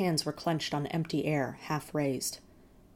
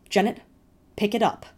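loud sharp command, pitched up; sharp order; 5 semitones higher, 7 dB louder than usual. A narrator's reading. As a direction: bark it at him. Mother ordering the brother.

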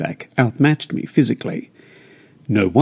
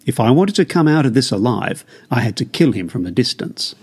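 plain reading voice

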